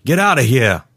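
urging her on. Acted. Security guard willing a woman to escape, urgent. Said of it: "urging Joy on".

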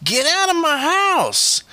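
very high, stretched-out screech; high shriek. A narrator's reading. Stepmother shoving boy from her house, shrieking. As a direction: shriek it slowly at the top of the voice. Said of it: "Her voice had risen even higher and louder".

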